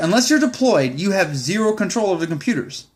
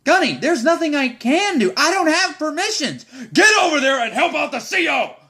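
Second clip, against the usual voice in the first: heated mock shout; 8 semitones higher than usual. Heated exchange with gunny, retold.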